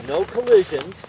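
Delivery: high, loud called-out warning; cheery ski instructor shouting. Real, not acted. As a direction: shout it up the slope to everyone. Instructor calls warning before descent.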